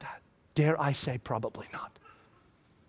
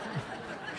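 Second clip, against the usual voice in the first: breathy laugh; 15 dB quieter than usual. Real, not acted. Speaker laughs at own scene.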